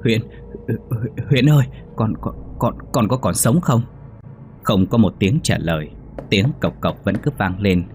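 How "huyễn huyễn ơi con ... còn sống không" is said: faltering, broken repeats of the son's name; a father too scared to finish the words; stammering in fear. Acted. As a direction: stutter out the question, half voiceless. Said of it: "ông ngoại tôi mới lắp bắp".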